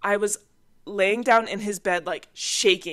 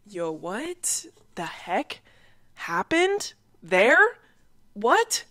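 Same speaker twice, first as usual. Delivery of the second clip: disbelief; 2 semitones higher, much slower than usual. Retold or imitated. Looking back in disbelief.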